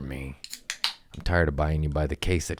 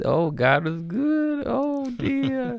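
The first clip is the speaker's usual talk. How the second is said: high, slow swooning exclamation; hammed-up blissful sigh; mock rapture; much slower than usual. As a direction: swoon up high, drawn out like a revelation.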